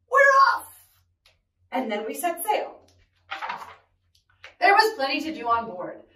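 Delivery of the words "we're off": high, loud crew cheer; shouting. Acted. Crew answers captain as they set sail, shouting. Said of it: "we all shouted".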